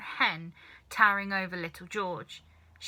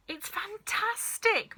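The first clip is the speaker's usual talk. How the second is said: much higher, slow thrilled shout; excited shout; 12 semitones higher, slower than usual. A narrator's reading. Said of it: "Mister Cranky shouted".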